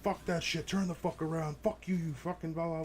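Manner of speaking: imitating hysterical rage. Retold drunk passenger cursing at his driver, mock hysterical rant. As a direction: ham up the drunk rider losing it. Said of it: "absolutely lose his shit".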